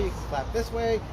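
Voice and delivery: ordinary delivery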